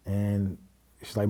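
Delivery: normal talking voice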